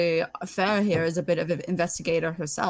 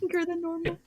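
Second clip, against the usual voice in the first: cutesy echo; 10 semitones higher, 5 dB quieter than usual. Real, not acted. Echoing friend's line cutely.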